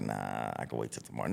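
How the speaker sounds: low, soft lazy mumble. Retold or imitated. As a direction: mumble the excuse to yourself. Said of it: "you fight with yourself like".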